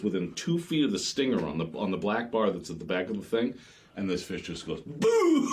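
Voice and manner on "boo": high, drawn-out boom; explosive impact noise. Retold or imitated. Angler telling of fish hitting squid bar, explosive noise. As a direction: imitate a big fish smashing the bait. Imitates fish smashing the bait.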